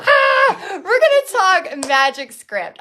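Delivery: loud, high excited shout. Real, not acted. Host hyping the class for magic script, excited shout. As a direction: burst out with excitement. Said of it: "I am so excited about this".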